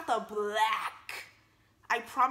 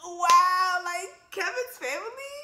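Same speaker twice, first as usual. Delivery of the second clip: mock shocked; 7 semitones higher, 6 dB louder, much slower than usual. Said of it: "he gonna look at Kevin like".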